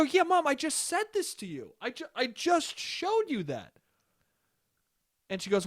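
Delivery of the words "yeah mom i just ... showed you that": high-pitched, exasperated protest; exasperated retort. Retold or imitated. Son reminding his mom he showed her, exasperated.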